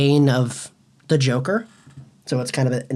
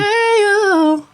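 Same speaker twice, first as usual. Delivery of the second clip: high, long whooping hey-o; cheer; 5 dB louder than usual. Real, not acted. Friend cheering as the script comes back, a long high whoop. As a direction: whoop it out in celebration.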